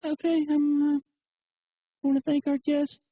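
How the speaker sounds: high, halting put-on woman's voice; mocking female radio host. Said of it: "she's like".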